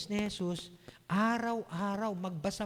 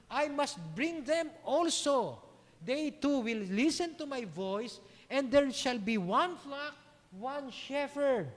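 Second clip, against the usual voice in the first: slow, high-pitched proclamation; emphatic scripture reading; 7 semitones higher, 3 dB louder, slower than usual. A narrator's reading. Preacher reading the words of Jesus to congregation, proclaiming. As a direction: proclaim each phrase slowly, with force.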